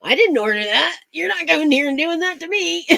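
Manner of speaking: mock-indignant, high-pitched protest; imitated outrage, rising sharply in pitch; worked up. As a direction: play a flustered prank victim, voice climbing high. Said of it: "Get 'em all worked up".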